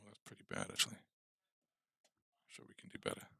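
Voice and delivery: ordinary talk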